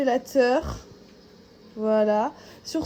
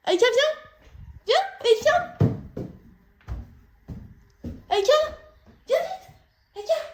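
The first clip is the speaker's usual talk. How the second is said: calling the dog. Real, not acted.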